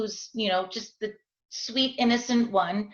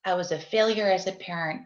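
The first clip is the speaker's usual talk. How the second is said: normal talking voice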